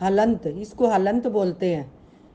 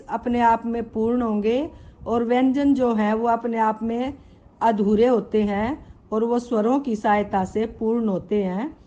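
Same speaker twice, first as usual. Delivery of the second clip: ordinary talk, nothing marked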